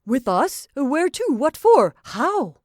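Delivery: high, loud stunned burst of questions; stammering surprise. A narrator's reading. Paul, stunned by her proposal, stammering loud questions. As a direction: blurt out each question in disbelief. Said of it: "He stammered".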